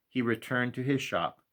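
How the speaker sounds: usual speaking voice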